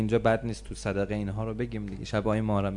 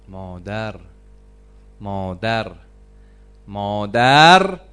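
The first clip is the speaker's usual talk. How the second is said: mother repeated, each louder, the last long and drawn out; building repetition; 3 semitones lower, 11 dB louder, much slower than usual. Real, not acted. Preacher calling مادر three times, building. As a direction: let مادر grow from low to a long cry.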